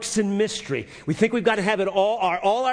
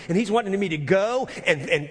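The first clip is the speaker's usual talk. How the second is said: ordinary talk, nothing marked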